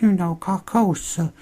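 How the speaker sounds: high, slow and strange; spooky old woman's hushed warning; eerie old lady voice. Retold or imitated. Retelling the creepy old lady's line, high and slow.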